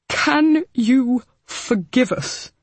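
slow, lightly voiced remorseful uncle; pleading. Abel begging Hal's forgiveness, remorseful. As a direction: ask it slowly, full of guilt.

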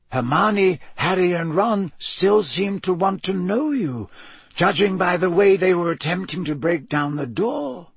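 put-on lightly amused elderly wizard; Dumbledore voice. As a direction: play the wise old headmaster, gently teasing.

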